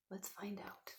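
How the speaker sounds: hushed, soft invitation; secret whisper. Shown as a demonstration. Teacher whispers before reading.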